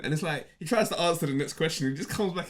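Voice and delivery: ordinary talk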